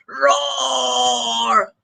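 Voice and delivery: a growling mock roar; hammed-up roar. Shown as a demonstration. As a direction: roar like a big cat, playfully. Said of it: "a roar goes like".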